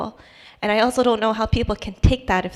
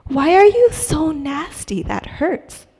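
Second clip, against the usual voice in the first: higher-pitched, pained reproach, imitated; hurt mother; 5 semitones higher, 3 dB louder than usual. Mom to her teenager after attitude, imitated hurt reproach. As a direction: voice a wounded mom confronting her child.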